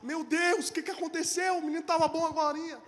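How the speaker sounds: father rolling on the floor, despairing; desperate cry. Retold or imitated. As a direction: cry out in disbelief. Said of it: "eu rolava no chão".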